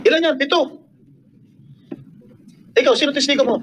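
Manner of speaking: high-pitched confrontational questioning. Real, not acted. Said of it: "paano hindi tataas ang boses namin?".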